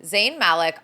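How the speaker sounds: Malik said as "MAL-ick"; first syllable of Malik stressed, with a short "a"; corrected Malik. Real, not acted. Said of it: "I don't know why I always say Malik".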